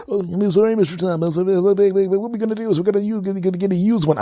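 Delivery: high, rambling excuse-making rep voice; high mock customer service voice; babbling company rep. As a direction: pile up nonsense excuses cheerfully.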